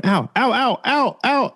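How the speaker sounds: rapid high-pitched "ow" repeated; imitated string of ows; mock pained yelps. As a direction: re-enact hopping pain with high yelps. Reenacts dancing around in pain.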